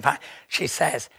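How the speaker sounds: ordinary delivery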